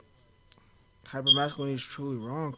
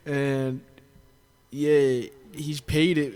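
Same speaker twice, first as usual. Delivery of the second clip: ordinary delivery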